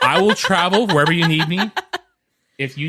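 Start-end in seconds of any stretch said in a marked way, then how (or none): 0.0-1.7 laughing. Real, not acted. Joking pitch to Bob Iger.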